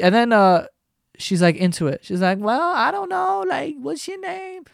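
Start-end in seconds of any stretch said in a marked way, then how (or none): 2.4-4.6 put-on much higher, coy girly voice fading softer. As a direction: play the girl suddenly charmed. Girl won over by the thug.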